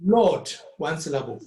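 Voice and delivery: ordinary talk, nothing marked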